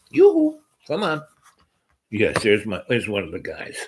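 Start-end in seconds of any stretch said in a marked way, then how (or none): 0.1-1.2 very high, loud yoo-hoo to the dog; sing-song call. Real, not acted. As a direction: call him in a high singing voice. Calling the dog over.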